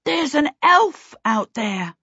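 put-on a boy calls out in surprise from the table; excited exclamation. A narrator's reading. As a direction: blurt it out, amazed. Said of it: "Peter exclaimed with his mouth full".

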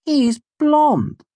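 very high, loud indignation; indignant protest. A narrator's reading. Offended denial of being the father.

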